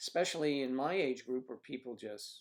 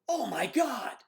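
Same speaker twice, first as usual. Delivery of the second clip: much higher, louder mock panic cry; imitating wife's shriek; 12 semitones higher, 10 dB louder, slower than usual. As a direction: shriek it like she does in the car. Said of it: "don't go".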